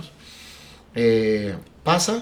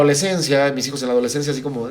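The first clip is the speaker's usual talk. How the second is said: ordinary talk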